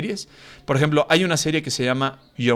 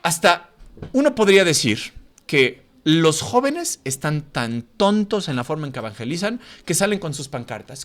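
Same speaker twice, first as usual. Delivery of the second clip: ordinary delivery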